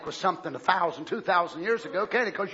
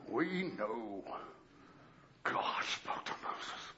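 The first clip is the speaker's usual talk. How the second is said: smug religious leaders; 11 semitones lower, 9 dB quieter, slower than usual. Retold or imitated.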